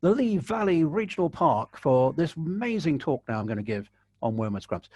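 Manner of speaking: high, slow, projected welcome; TV presenter voice. Shown as a demonstration. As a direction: announce it like a TV host. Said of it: "You've just got to come on and say".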